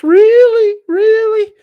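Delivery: mocking, very high and loud squeal. Host mocking Dan's "really" back at him, in a loud high voice. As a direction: mimic him in a piercing falsetto, drawn out. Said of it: "Say it in your high voice".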